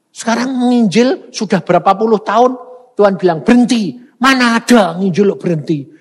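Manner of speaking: loud, high, driving sermon voice; preacher raising his voice to the congregation; fiery preaching. Acted. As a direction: preach it at full force, voice soaring. Preaching loudly again.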